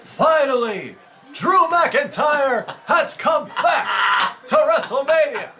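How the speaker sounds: booming, drawn-out wrestling promo; The Rock. Retold or imitated. Friend replaying the WrestleMania impression, loud promo mimicry. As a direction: shout it high and slow, pausing for the crowd. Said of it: "doing the Rock impression".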